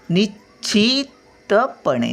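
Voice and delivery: निश्चितपणे said slowly, high start; slow careful syllables. Shown as a demonstration. Grandmother teaching a word to children, slowly.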